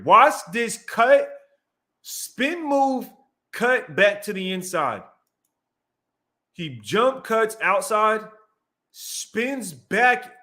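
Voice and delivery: high-pitched, drawn-out amazement at each move; excited play breakdown. Real, not acted. Streamer replays a dazzling run for viewers, excited.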